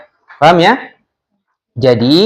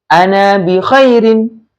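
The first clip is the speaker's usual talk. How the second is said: slow, higher-pitched teacher's model, falling at the end; model reading for repetition; 4 semitones higher, slower than usual. Shown as a demonstration. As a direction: say it slowly for the class to copy. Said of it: "kalian tirukan lagi ya". (dibacakan pelan untuk ditirukan)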